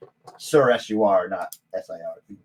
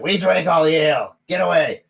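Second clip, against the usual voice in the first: high, raised retort; acted rowdy dwarf shout; dwarf yelling back; 6 semitones higher, 4 dB louder than usual. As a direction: holler it across the tavern.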